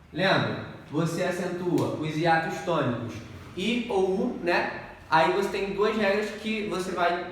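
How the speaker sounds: ordinary talk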